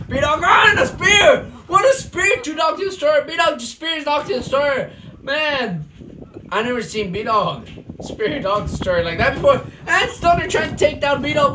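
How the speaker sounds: loud, pumped-up wrestling commentary with yells at big moves; live play-by-play. Acted. Commentator calling a backyard wrestling match, hyped play-by-play. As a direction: call the match live for the crowd.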